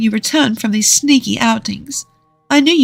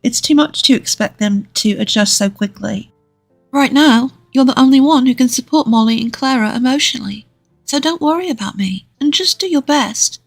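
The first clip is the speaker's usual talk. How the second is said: higher-pitched comforting wife voice; 6 semitones higher than usual. A narrator's reading. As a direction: reassure your husband in a high female character voice. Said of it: "she comforted me".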